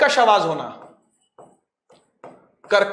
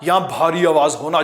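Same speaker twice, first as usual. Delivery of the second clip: deep heavy voice; 6 semitones lower than usual. Shown as a demonstration. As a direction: drop the voice low and heavy. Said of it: "voice modulation से करके दिखाऊंगा".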